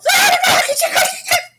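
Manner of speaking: very high, loud wordless jabber; mock squabbling shriek. Imitating the wives at it, shrill loud gibberish squawking. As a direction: screech like two people bickering.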